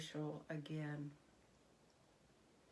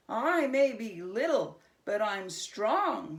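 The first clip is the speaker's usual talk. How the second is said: louder, puffed-up little creature; defiant goblin boast; 2 semitones higher, 7 dB louder than usual. A narrator's reading. Goblin to Herschel, louder and defiant. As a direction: act bigger than you are.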